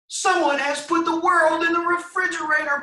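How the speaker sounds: high, squeaky little chick voice; peeping chick. A narrator's reading. Smallest chicken peeping to the flock, squeaky. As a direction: peep it out like a tiny chick. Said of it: "peeped the smallest chicken".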